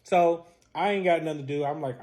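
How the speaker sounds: ordinary delivery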